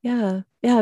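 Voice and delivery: ordinary talk, nothing marked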